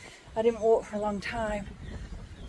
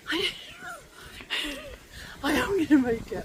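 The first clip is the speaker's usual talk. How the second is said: panting hard between words, breathy gasps; winded words pushed out with a sigh; out of breath; 6 semitones higher, 4 dB quieter than usual. Real, not acted. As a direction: gasp it out after the steep climb. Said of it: "no energy in my legs".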